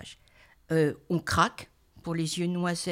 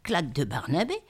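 usual speaking voice

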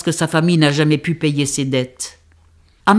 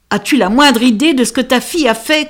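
higher raised accusing question; shouted at wife; 7 semitones higher, 3 dB louder than usual. Acted. Father shouting to the mother about the daughter, raised voice. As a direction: call out the daughter's fault. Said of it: "cria-t-il".

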